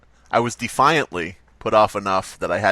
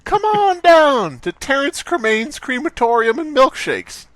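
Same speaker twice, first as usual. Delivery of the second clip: much higher, louder, slow pitchman call; mock ad pitch; 4 dB louder, slower than usual. Callback ad for the crematorium milkshake shop, hammed-up pitchman. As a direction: belt it like a cheesy TV commercial.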